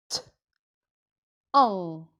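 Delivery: short T, pause, long low An; slow sound by sound. Shown as a demonstration. Teacher repeating a syllable slowly, sound by sound. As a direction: sound out T and An separately. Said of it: "Je vais dire ton tout doucement".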